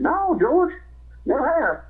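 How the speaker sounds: high, feigned innocent reply; mock-innocent [name]. [name] answers George in the prank, high mock-innocent voice. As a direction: play dumb with a high voice.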